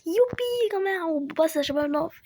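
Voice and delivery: high, loud victory whoop; the player celebrates his win with a loud high whoop; excited cheer. Real, not acted. Player thinks he won the round.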